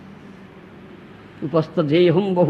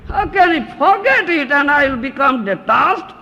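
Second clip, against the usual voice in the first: louder, higher pleading of the attached man; mock protest; 7 semitones higher, 6 dB louder than usual. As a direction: protest like he can't let go.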